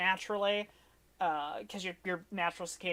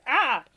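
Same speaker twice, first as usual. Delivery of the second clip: startled yelp; 7 semitones higher, 9 dB louder than usual. Acted. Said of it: "He kinda go".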